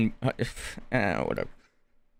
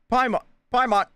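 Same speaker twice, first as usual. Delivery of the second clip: urgent calling; 10 semitones higher, 4 dB louder than usual. Acted.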